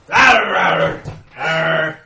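loud growling roar, hammed up; loud playful roar. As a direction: roar it out like a lion. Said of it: "we come roaring back".

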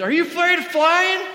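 high, loud impression of a drunk lady on a plane; tipsy lady voice. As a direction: play the tipsy passenger who blurts it out. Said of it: "she goes".

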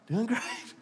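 a hushed, sugary put-on brightness; church smile. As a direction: say it through a pasted-on smile. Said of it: "Church smile".

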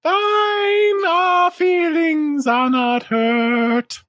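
very slow, high, loud proclamation; high mushroom shout. Acted. Mushroom loudly insisting to the party that its feelings aren't hurt. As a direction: declare it slowly at full squeak.

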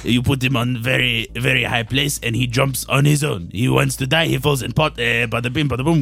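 put-on low French-accented recipe patter; French chef.

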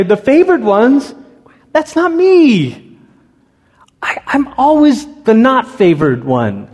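a touch of hurt self-doubt; insecure doubt. Retold or imitated. Congregant doubting they are favored, mildly hurt and pleading. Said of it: "we say".

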